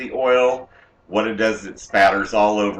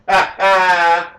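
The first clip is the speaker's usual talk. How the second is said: loud laugh; 8 semitones higher, 7 dB louder than usual. Real, not acted. Laughs at joke.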